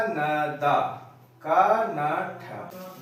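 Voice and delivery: "न दा", then "का न ठ" very slowly, each syllable apart. Shown as a demonstration. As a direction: sound out "का न ठ" slowly, one syllable at a time. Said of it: "तोड़ के समझते हैं".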